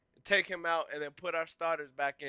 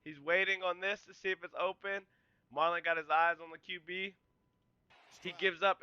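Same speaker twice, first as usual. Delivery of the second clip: ordinary talk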